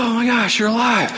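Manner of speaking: high-pitched amazed squeal; excited amazement. Retold or imitated. Nala finds Simba alive, excited amazement. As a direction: act out a thrilled reunion.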